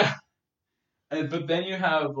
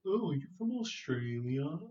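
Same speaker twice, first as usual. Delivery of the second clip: slow, softer imitation of a curious question; naive guesser; 3 semitones higher, 5 dB quieter than usual. Friends meeting a New Zealander, slowly wondering. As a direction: draw out the mistaken question.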